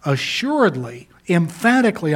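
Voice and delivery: strong stressed syllables on Assuredly, emphatically, set apart by a pause; special emphasis. Retold or imitated. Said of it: "Jesus wants to put special emphasis".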